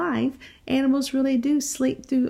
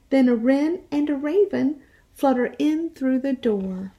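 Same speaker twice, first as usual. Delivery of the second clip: put-on lively read-aloud voice for young children; animated picture-book reading; storytime narration; slower than usual. A narrator's reading.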